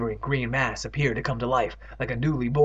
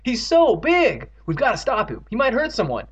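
high, loud urgent exclamations; alarmed cry; 8 semitones higher, 4 dB louder than usual. A narrator's reading. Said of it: "Evan cried".

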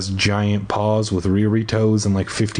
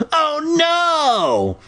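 very high, drawn-out wail of horror; mock scream. A narrator's reading. Kid rounding the corner, hammed-up high scream. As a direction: squeal it long and high, hammed up. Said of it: "I screamed".

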